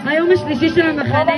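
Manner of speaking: shouted camp announcement, rising end; loud announcement. Real, not acted. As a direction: announce it loudly to the crowd. Counselor announcing to the whole camp. (הכרזה בקול רם)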